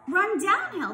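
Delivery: high, loud call; shouted advice. A narrator's reading. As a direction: call it out across the farm. Animals shout advice in chase.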